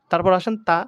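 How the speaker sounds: normal talking voice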